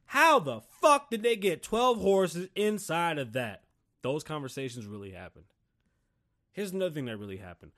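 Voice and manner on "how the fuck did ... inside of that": loud, high, drawn-out disbelief; incredulous outburst. Acted. Dad told the car has twelve horses, incredulous outburst. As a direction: demand to know how it's possible.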